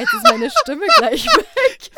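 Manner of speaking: very high, loud and drawn out; squealed, laughed-out complaint. Real, not acted. Host after trying the full-throttle laugh, loud squeal. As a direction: squeal it slowly at the end of a laughing fit.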